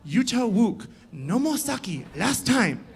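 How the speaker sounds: a hint of a stern shop owner's accent; mock Chinese accent. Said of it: "He goes".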